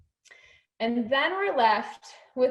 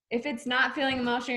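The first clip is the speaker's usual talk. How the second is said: ordinary talk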